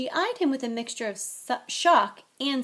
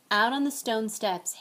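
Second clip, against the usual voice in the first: usual speaking voice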